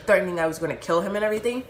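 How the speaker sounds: ordinary delivery, nothing marked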